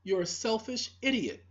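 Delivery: usual speaking voice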